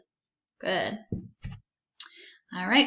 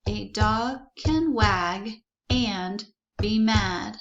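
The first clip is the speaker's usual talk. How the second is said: slow, deliberate words; slow sentence reading; much slower than usual. Shown as a demonstration. Teacher reading the sentence slowly with the class. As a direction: read each word out slowly.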